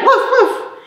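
high-pitched playful woofs; dog bark. Shown as a demonstration. Teacher answering children on how dogs sound, playful dog bark. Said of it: "How does the dog sound?".

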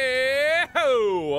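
high, loud whoop of success; drawn-out victory holler; celebrating cry. Real, not acted. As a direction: whoop it out in triumph. Celebrating a solved code.

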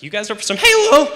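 loud cracking squawk on Halo; acted teen voice crack. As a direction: let Halo break high mid-invitation. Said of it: "Unless you make fun of yourself first".

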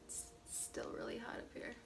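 soft unvoiced whisper while busy; whispering to herself. Real, not acted. Vlogger curling hair mutters to herself in a whisper. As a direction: murmur half to yourself, absorbed in the task.

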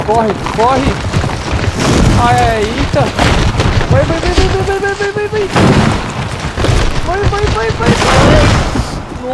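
very high, loud frantic cheering; excited shouting. Real, not acted. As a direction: scream the character on. Still chased, cheering character on.

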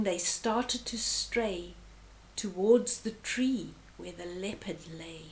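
ordinary delivery

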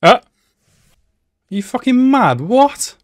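high, loud disbelieving yell at the screen; shocked outburst. Real, not acted. Viewer yelling at the anime in shock. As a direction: blurt it out in sudden shock.

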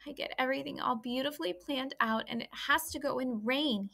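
a pouting little pig whose plans are ruined; disappointed young pig. Acted. Wilbur the pig complains.

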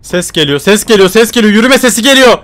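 from plain to high and loud alarm; building panic. Real, not acted. Hears footsteps approaching. (giderek artan panikle, sesi yükselerek)

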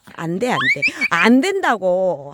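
loud, slowing stern no; scolding the dog. Real, not acted.

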